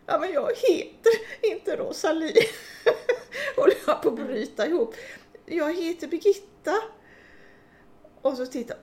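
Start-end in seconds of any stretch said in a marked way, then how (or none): 0.1-2.3 laughing protest. Real, not acted. 2.4-3.5 high burst of laughter. Real, not acted. Breaking down in laughter. 3.6-6.7 laughing protest. Real, not acted.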